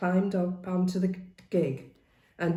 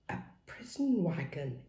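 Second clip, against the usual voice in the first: under his breath; 5 dB quieter than usual. A narrator's reading. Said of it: "he exclaimed under his breath".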